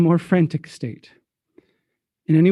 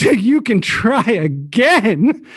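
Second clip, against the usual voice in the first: higher, drawn-out gleeful taunt; mocking, bursting with laughter; 6 semitones higher, 4 dB louder, much slower than usual. Francois mocks the guild leader, rolling in laughter. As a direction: crow it slowly, about to crack up. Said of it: "rolling in laughter".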